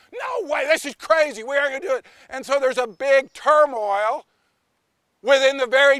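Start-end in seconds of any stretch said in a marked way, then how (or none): 0.1-2.0 high, shouting refusal; flatly refuse, outraged; indignant protest. Acted. Love and mercy refusing justice's verdict, high and outraged. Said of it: "Justice goes".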